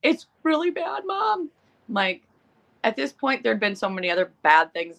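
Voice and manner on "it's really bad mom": put-on much higher whiny kid voice; imitating her son. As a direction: mimic a guilty little boy pleading. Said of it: "He goes".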